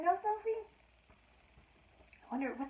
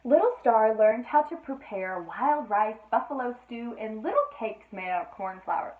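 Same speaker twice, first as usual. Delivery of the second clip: lively read-aloud storytelling voice; storybook reading; 9 dB louder than usual. A narrator's reading. Mother reading a bedtime story to Sophie, lively. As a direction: read the tale to a small child.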